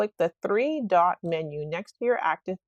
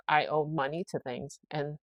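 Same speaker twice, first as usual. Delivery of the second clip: ordinary talk, nothing marked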